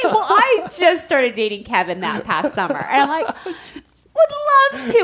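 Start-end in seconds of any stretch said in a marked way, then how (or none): 3.4-5.0 much higher put-on enthusiasm; hammed-up eager yes; fake eagerness. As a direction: pretend you can't wait. Forced eager reply to try on.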